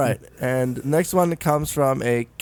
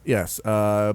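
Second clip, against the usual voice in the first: ordinary talk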